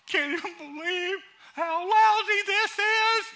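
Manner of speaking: high, loud, whining self-pity with laughter; whiny victim. Retold or imitated. Pastor mocking a self-pitying man's whining to the congregation. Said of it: "He said".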